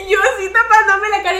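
high, loud, laughing as you tell it; real laughter breaking into the words. Real, not acted. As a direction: tell it while cracking up. Cracking up while retelling church giggles.

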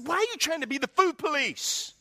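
high-pitched exasperated outburst; retold angry snap. Husband snapping at wife over his food, acted exasperation.

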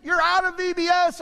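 high-pitched mock scolding lady; mocking a drill-sergeant lady ordering a child out; stern lady. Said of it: "must have been a drill sergeant".

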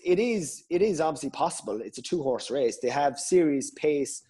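ordinary talk

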